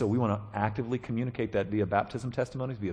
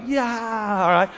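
high, drawn-out mock cheer; whooping with glee; 9 semitones higher, 5 dB louder, much slower than usual. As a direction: whoop like a grown man loving the kiddie pool. Imitates man whooping in kiddie pool.

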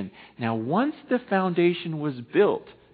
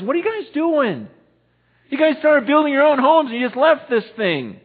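high, loud rebuke; scolding prophet; 9 semitones higher, 3 dB louder than usual. Acted. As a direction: demand to know why they stopped. Haggai scolding the people.

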